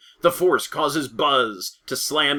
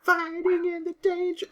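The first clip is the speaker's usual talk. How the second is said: put-on little girl voice; 5 dB quieter than usual. Echoing line in girl voice.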